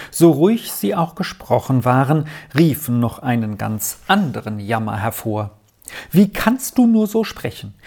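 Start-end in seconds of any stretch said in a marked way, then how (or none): 6.1-7.7 much higher, distraught outcry; agitated cry. A narrator's reading. Aunt horrified at her brother's talk of death, distraught. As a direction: cry out in alarm. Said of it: "rief die erregte Tante".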